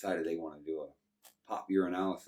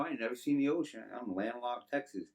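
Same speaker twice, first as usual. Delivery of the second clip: usual speaking voice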